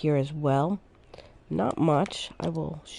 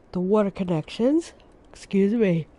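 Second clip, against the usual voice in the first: yawning. Real, not acted. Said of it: "I don't know why I'm yawning".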